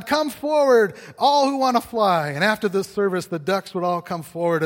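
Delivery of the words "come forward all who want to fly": high, loud preacher's call; fiery altar call. Acted. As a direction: call them forward like a revival preacher. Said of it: "he would preach on".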